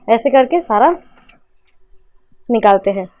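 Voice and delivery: normal talking voice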